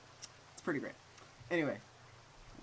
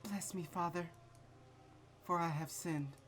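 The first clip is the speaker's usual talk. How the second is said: softly voiced confession; 4 semitones higher, 6 dB quieter than usual.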